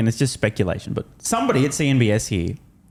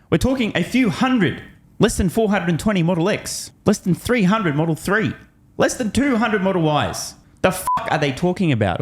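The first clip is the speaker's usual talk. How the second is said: incredulous; 7 semitones higher than usual. Real, not acted. Incredulous rant over inventory numbers.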